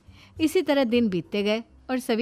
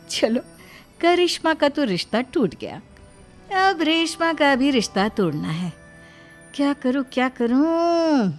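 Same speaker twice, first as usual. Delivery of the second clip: louder, higher plotting to herself; scheming sister-in-law; 5 semitones higher, 6 dB louder than usual. Acted. Sister-in-law plots to break the second match, scheming. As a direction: scheme aloud, drawing out the last words.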